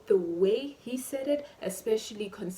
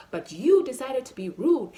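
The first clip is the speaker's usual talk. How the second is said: louder, indignant accusation; raised-voice scolding; angry; 4 semitones higher, 8 dB louder than usual. Acted. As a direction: throw the blame at him. Scolding the waiter.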